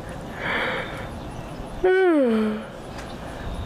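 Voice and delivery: long, breathy demo yawn; big yawn. Shown as a demonstration. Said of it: "I'm gonna yawn".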